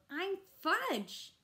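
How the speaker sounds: put-on high, loud little-boy yell. A narrator's reading. Little boy yelling his name at his family. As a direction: let go and yell your name. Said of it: "really let go and yelled".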